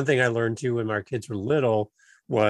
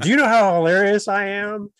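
loud, high mock bragging; mock boasting; 6 semitones higher, 8 dB louder than usual. Joking boast about being funny.

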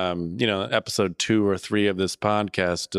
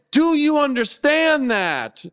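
high, slow, indignant reenactment; hammed-up exasperated demand; putting foot down; 4 dB louder, slower than usual. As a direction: hammer it out slowly, as if retelling your own outburst. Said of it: "put my foot down".

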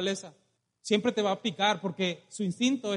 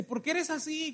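high-pitched, softer protest; mock hurt complaint; 6 semitones higher, 5 dB quieter than usual. Stung dog confronts the scorpion, mock hurt complaint. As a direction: complain like a wronged little dog.

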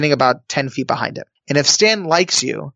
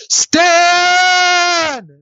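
long, high full-out yell; 4 dB louder than usual. Retold or imitated. Contestant desperately stops the producer walking away, long high yell. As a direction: yell the name at the top of your lungs. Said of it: "I just started yelling at the top of my lungs".

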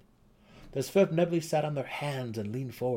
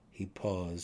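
ordinary delivery, nothing marked

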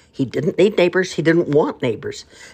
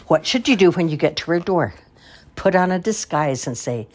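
plain reading voice

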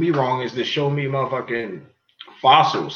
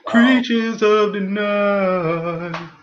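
spooky announcer; 6 semitones higher, much slower than usual. Pretend. Hosts hyping their podcast name.

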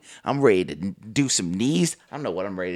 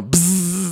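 high buzzing lawnmower noise; mimicking a clunker's drone; engine buzz imitation; 7 semitones higher than usual. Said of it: "that's how it sounds".

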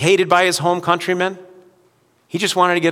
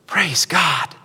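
whispered praise. Real, not acted. Quiet aside after the peak.

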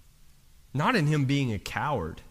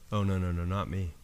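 Peter's denial, lower and softer; low hushed denial; 9 semitones lower, 5 dB quieter, faster than usual. Retold or imitated. Peter quietly denying it to the servant girl. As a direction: mutter it, backing away.

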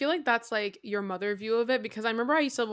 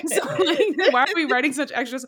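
words said through laughter, pitched up; laughing; 5 semitones higher, 6 dB louder than usual. Real, not acted. Laughing at her old diary entries. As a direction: laugh the question out.

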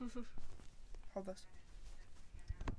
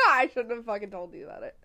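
normal talking voice

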